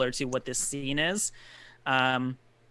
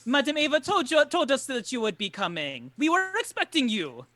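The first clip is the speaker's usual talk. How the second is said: higher-pitched, emphatic character voice; Jarek, insistent host; 6 semitones higher than usual. Acted. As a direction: explain eagerly to the confused newcomers. Game master voices Jarek explaining.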